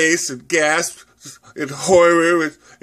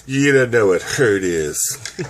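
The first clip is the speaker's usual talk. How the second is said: low slow menace; 7 semitones lower than usual. Acted. Menacing reply to threat.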